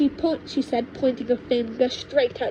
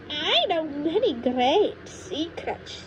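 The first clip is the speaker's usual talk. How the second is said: higher, slow sly tease; witch grandma teasing; 5 semitones higher, slower than usual. Acted. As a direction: dangle the secrets slowly. Witch grandma luring George.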